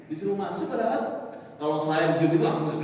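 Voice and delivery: usual speaking voice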